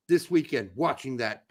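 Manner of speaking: ordinary talk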